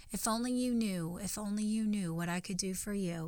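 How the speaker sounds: a soft, knowing reply from above; God speaking gently. Retold or imitated. God gently correcting the small-minded believer, quiet and tender. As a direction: voice of God, hushed and patient. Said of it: "God's like".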